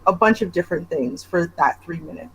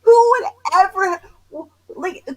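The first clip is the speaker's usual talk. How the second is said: very high-pitched, louder disbelief; incredulous outrage; 14 semitones higher, 4 dB louder than usual. Real, not acted. Outraged at the husband scenario.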